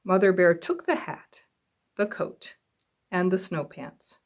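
ordinary delivery, nothing marked

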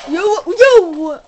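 high, very loud drawn-out yell; alarmed yell. Real, not acted. Player yelling at an attacking zombie, alarmed. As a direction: yell it slowly, then break off.

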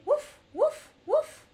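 bouncy high puppy barks; dog woof. Shown as a demonstration. Telling kids what doggies say, high playful woofs. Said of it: "And the doggies say".

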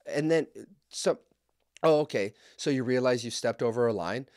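ordinary talk, nothing marked

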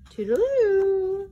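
high, loud, slow sing-song farewell; cheery goodbye. Acted. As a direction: trill it out breezily. Mouse cheerily leaving.

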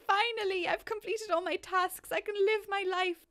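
high, bright voicing of a relieved Sim; happy Sim voice. Gamer voicing her happy Sim, high and relieved. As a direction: speak for her as if freed at last. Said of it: "She's like".

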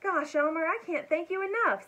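high, loud, excited thanks; gushing gratitude. A narrator's reading. The grateful dragon thanks Elmer after escaping, gushing. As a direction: gush with thanks.

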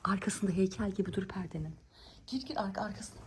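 hushed unvoiced whisper; whispering while hiding. Real, not acted. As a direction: whisper so the dog can't hear. Hiding child, whispering instructions.

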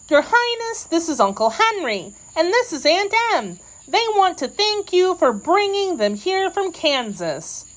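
put-on high-pitched girl's voice; young Dorothy, presenting. A narrator's reading. Dorothy introduces her relatives to the queen, high and girlish.

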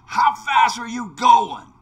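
cowboy calling to a stranger across the station, loud; sheriff's challenge. Retold or imitated. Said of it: "what he said to me was".

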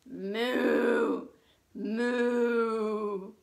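low drawn-out moo; cow moo. Pretend. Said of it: "Say it with me".

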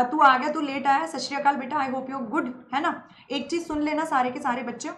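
normal talking voice

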